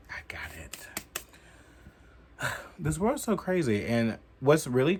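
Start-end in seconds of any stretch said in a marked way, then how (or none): 0.1-0.9 whisper. Real, not acted. Aside while picking up flyer.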